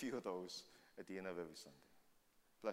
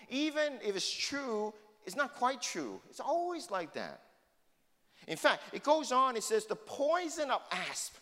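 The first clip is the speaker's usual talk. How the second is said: preaching at full force; 8 semitones higher, 7 dB louder than usual. Acted. Pastor preaching to his congregation about sinful tongues, full-force preaching. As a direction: drive the scripture home to the pews.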